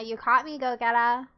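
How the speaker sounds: ordinary talk, nothing marked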